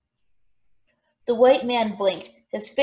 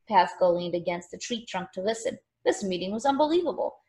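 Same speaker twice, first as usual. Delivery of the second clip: ordinary delivery, nothing marked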